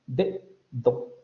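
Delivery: De, do with both d's exploded; two hard stops. Shown as a demonstration. Teacher repeating the over-careful version. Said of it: "hacer las dos oclusivas".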